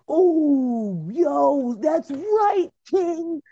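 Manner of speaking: high, hyped-up cheering of a buddy; mock fanboy. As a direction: ham up a yes-man hyping his king. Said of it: "they're just like".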